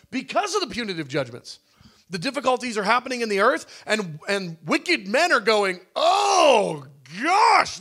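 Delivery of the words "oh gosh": mock dismay. Wicked men crying out.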